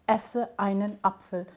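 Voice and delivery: "esse einen Apfel" with a hard onset on each word; abrupt glottal stops. Shown as a demonstration. Linguist repeating the example, abrupt "esse einen Apfel". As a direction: separate "esse einen Apfel" with throat catches. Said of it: "that sounds to us very abrupt".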